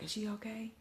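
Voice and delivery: high, hushed question; cousin's half-concerned check. Retold or imitated. Cousin checks on her after the bang, hushed high question. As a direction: ask it small and high, under the breath. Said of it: "concerned like that".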